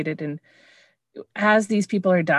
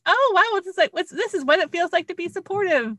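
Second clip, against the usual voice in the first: much higher, breathless wide-eyed voice; amazed wonder; 12 semitones higher than usual. Retold or imitated. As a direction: gasp as if it's the first time you've felt this. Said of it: "She's like".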